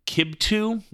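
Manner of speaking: "kibtu" said KIB-too, stress on first syllable; pronouncing kibtu. Shown as a demonstration. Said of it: "it's pronounced zizu".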